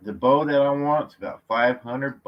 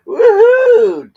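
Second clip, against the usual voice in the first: very high, loud yelp of a whoop; ticklish whoop; 16 dB louder than usual. Real, not acted. Man whoops loudly as the dog tickles his toes. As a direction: let out a tickled shriek.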